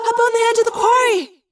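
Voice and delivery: high, loud scream of alarm; sustained high-pitched shriek; Cassie screaming. A narrator's reading. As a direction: scream a frantic warning. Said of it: "Cassie screamed in our heads".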